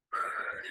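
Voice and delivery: soft, audible calming breath; demonstrated breath. Shown as a demonstration. Mom showing upset daughter how to breathe, soft audible breath. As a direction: breathe slowly as if coaching a child. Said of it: "Wait a minute, breathe".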